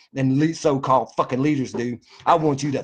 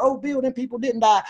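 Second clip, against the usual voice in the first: high mock voice whining his name; mocking whiny critic; 10 semitones higher than usual. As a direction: sneer at the critics by aping them high.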